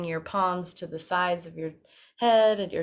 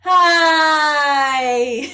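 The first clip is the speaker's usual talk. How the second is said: loud high call out; sound ball call; 8 semitones higher, 11 dB louder than usual. Shown as a demonstration. Voice coach throwing a sound ball to the class. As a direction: fling the word out like a ball. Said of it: "Find a smile".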